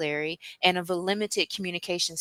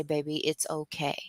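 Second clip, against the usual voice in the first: calm soothing voice; 5 dB quieter than usual. Shown as a demonstration. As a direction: soothe a panicking daughter gently. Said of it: "talk very calmly and say".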